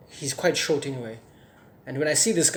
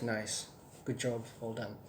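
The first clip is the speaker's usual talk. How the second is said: smirking praise; 3 semitones lower, 12 dB quieter, slower than usual. Retold or imitated. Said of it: "does, like, a smirk".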